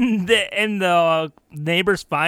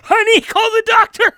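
high, loud mock panic; 11 semitones higher, 4 dB louder than usual. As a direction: fake a stroke to dodge the question. Guilty man fakes stroke.

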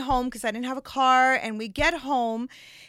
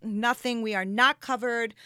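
usual speaking voice

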